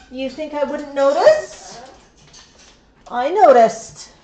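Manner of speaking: loud, slow mock-stern telling-off; playful scolding. Real, not acted. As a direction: tease the bird for being caught. Playful scolding of sneaky bird.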